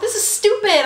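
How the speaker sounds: high loud huff; mock angry. Ranting to hubby about the show, high and loud. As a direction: scoff at the show like a fed-up kid. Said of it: "I was like".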